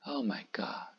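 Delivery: hushed whisper of awe. Real, not acted. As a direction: breathe it out in wonder.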